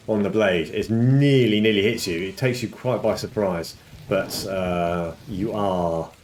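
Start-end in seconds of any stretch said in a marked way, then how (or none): none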